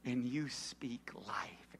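slow hushed whisper; slow intimate whisper. Real, not acted. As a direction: breathe it out slowly, barely voiced. Preacher whispers the point.

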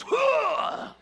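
high, loud "huh" bellow; hammed-up battle roar; loud mock roar. Acts David's roar before Goliath.

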